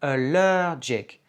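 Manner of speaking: ALLERGIC stressed on LER, drawn out slowly; a-LER-gic, punch the middle syllable of ALLERGIC; second-syllable stress. Shown as a demonstration. Teacher models English allergic, second-syllable stress demo. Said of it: "accent tonique sur la deuxième syllabe".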